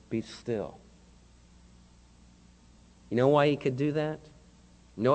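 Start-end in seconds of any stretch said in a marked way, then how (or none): 0.1-0.8 soft, low, quiet command; calm command. Acted. Jesus to the raging sea, soft low command. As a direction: still the storm with a hush.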